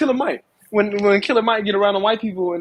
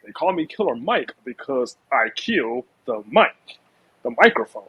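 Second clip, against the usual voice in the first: mock-proper, cleaned-up voice, slightly lower; careful, lower-pitched mock explanation for polite company; 3 semitones lower than usual. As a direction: imitate a rapper politely sanitizing his stage name. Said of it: "he tried to clean it up".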